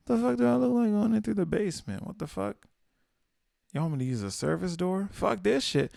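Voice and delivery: high-pitched, hammed-up indignation; playful affronted rant; mock outrage. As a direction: protest as if insulted, only kidding. Mock outrage, then just kidding.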